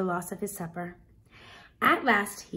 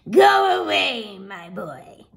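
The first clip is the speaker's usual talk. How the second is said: stern ogre's wife; 6 dB louder, slower than usual. Acted. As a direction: shoo the boy away firmly. Woman shoos Jack off.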